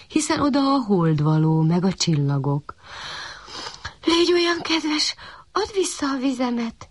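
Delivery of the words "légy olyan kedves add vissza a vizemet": high, gently begging; pleading puppy. A narrator's reading. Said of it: "kérlelte a kiskutya". (kérlelő kiskutyahang)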